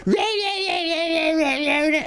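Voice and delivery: very high-pitched mock VTuber babble; cartoonish imitation of a screechy streamer; screaming VTuber impression. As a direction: squeal like an over-excited streamer, mockingly. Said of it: "and then they go".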